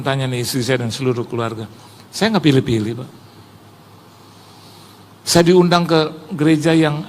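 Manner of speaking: ordinary talk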